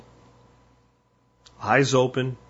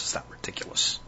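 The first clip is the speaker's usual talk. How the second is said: whisper; 11 dB quieter than usual. Real, not acted. Aside after ludicrous.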